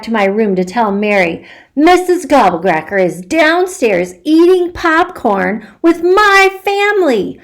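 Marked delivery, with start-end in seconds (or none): 1.8-7.4 excited. Acted. Child rushes to tell secret.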